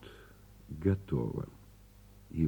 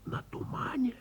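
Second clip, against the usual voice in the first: high whispered На тумане; a whispered, amazed На тумане; awed whisper; 7 dB quieter than usual. A narrator's reading. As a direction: whisper it in wonder. Narrator whispers the marvel.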